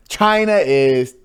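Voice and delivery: slow, high put-on Chinese sounds; mock Chinese accent. Friend imitating Chinese speech in a slow mock accent. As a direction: stretch out fake Chinese words. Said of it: "Just do the worst accent".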